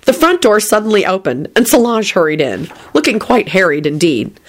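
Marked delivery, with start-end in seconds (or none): none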